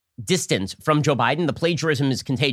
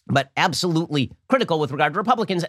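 normal talking voice

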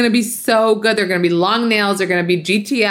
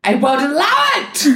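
higher, hammed-up self-quote through a laugh; mock screaming; 9 semitones higher than usual. Host retelling a fight with her sister, mock indignant. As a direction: play your own outrage as a laughing mock yell. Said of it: "I got to the screaming where I was like".

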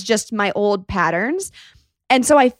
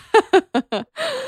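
a high, bright burst of real laughter; 13 semitones higher than usual. Real, not acted. Guest laughing at her own confession about family.

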